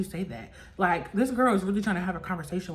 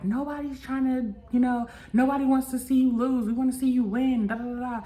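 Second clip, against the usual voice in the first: higher-pitched, lightly voiced mentor; imitating a mentor; 6 semitones higher than usual. Mentor telling her nobody wants her to lose, higher encouraging voice. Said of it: "saying it on, like, a mentor level".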